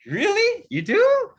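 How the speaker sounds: high squeak of disbelief; stunned, pitch shooting up; shocked disbelief. Retold or imitated. Shocked reaction to sudden yes.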